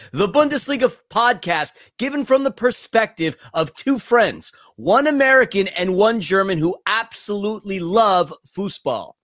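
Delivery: polished podcast intro voice; show-opening announcer. Acted. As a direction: present the show with swooping emphasis.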